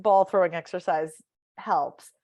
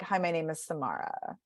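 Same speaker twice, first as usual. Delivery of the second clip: softer, falling into creaky fry; vocal fry; 8 dB quieter than usual. Shown as a demonstration. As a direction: let the name sink into fry. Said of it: "you end up with vocal fry".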